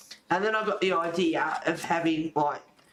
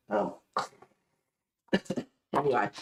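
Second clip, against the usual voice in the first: usual speaking voice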